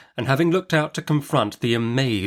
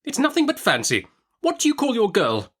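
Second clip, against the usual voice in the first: high, brisk stern aunt; Miss Betsy; 13 semitones higher than usual. Acted. Aunt Betsy interrogating the young mother, brisk and high. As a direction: snap the question like a stern old aunt.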